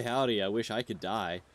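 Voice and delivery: ordinary talk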